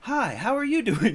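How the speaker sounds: imitating a perky friendly voice, lightly; mock chirpy voice. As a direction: parody the client's bubbly sample. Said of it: "And the voice is like".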